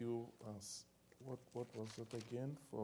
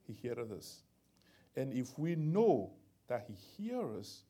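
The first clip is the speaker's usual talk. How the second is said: loud scripture reading; 7 dB louder than usual. Acted. Reading scripture aloud emphatically.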